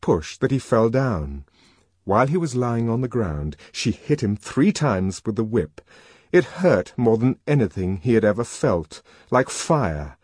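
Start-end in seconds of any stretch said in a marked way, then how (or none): none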